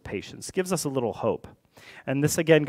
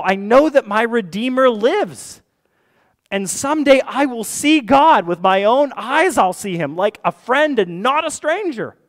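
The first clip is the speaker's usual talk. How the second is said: high, loud, ringing pulpit delivery; impassioned preaching; 6 semitones higher, 5 dB louder than usual. Real, not acted. Preacher holding up farmer, prophets and Job as hope, impassioned. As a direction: proclaim it with swooping, forceful emphasis.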